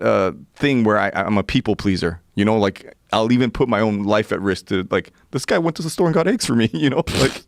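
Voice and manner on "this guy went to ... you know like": ordinary talk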